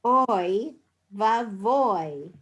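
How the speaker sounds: Oy vavoy drawn out slowly, leaning on oy; slow model. Shown as a demonstration. Teacher modelling the phrase for students to repeat. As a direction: say Oy vavoy slowly for them to copy. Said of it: "now say the Hebrew phrase for oh my".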